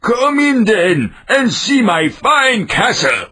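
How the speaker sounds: proud boastful ogre. Acted. Ogre invites the cat in, slow and boastful.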